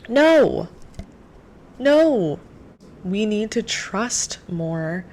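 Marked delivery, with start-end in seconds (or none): 0.1-2.4 sharp high loud no; firm, raised no; emphatic no. Real, not acted. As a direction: shut the idea down firmly. Emphatic refusal of doubting God.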